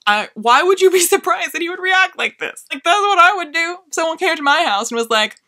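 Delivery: high, loud mock-incredulous rant; squeaky, loud retelling of her own disbelief; exasperated disbelief. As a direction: pitch it up like you can't believe anyone's surprised. Mocking own exasperated reply.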